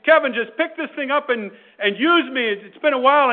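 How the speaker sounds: loud, high-pitched pleading phone; mock scream. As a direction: call out insistently as the nagging smartphone. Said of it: "screaming out to you".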